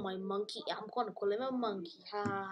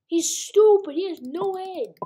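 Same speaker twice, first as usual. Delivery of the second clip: loud, high mocking yell; 8 semitones higher, 11 dB louder than usual. Real, not acted. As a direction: shout the insult gleefully. Mocking the other player.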